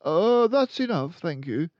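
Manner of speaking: put-on high, loud child voice, a mild murmur; politely decline, nervous; murmured boy. A narrator's reading. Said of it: "the boy murmured".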